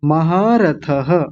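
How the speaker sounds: महारथः slowly, with a clear final ha; voiced visarga. Shown as a demonstration. Teacher repeats it for the visarga, slow महारथः ending in ha. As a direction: sound out महारथः as ma-haa-ra-tha-ha. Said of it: "विसर्ग का जरूर ध्यान रखेंगे".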